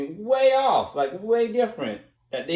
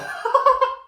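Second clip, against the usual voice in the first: a quick, high burst of laughter. Real, not acted. After mocking himself, a high laugh.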